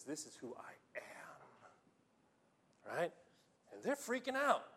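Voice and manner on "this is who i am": slow, breathy whisper; a slow, reverent whispered claim. Retold or imitated. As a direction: let it fall to a hush. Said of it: "Jesus says".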